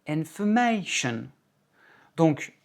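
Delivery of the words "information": slow English "information", long accented MA; English word stress. Shown as a demonstration. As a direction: stress inforMAtion on MA, then let it fall. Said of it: "quatre ou cinq notes plus haut".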